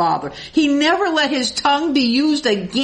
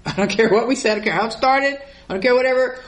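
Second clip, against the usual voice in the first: normal talking voice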